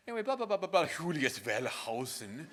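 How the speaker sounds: mock a colleague's heavy German accent on the name; imitating thick German accent. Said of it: "this very thick German accent".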